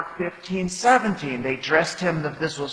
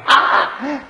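loud high mocking "Ha!"; jeering laugh; 12 semitones higher, 8 dB louder than usual. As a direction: bark a scornful laugh at him. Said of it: "shouting abuse".

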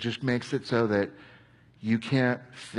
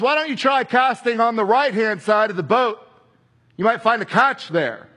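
higher, louder call across the water; Jesus calling out; 8 semitones higher, 5 dB louder than usual. Acted. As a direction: call out kindly to the boat.

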